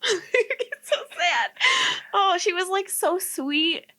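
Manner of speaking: high, slow, tearful voice breaking; real crying. Real, not acted. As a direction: cry through the words about a lost pet. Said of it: "I'm crying again".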